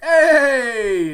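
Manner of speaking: sudden high shouted hey; loud greeting. Retold or imitated. Coworker greeting the podcaster, a loud high hey. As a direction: call out hey across the shop.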